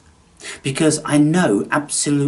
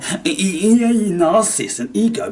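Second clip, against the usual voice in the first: higher, louder stuttered jeer; mock stammering troll; 8 semitones higher, 6 dB louder than usual. Mocking commenters who can only stammer insults. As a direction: stutter it like a clumsy heckler.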